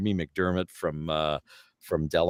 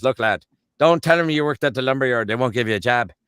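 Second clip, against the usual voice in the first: higher, louder Newfoundland lad; urgent Newfoundland-accented advice; 5 semitones higher, 6 dB louder than usual. Acted. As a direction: warn a buddy in a Newfie accent. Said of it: "jump the pond over to Newfoundland".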